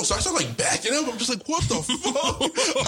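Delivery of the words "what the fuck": high, slow, drawn out through laughter; retelling the chase, laughing in disbelief. Retold or imitated. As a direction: stretch out the what the fuck in amazement. Teacher chases him, retold.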